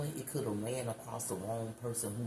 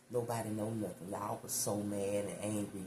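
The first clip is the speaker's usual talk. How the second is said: normal talking voice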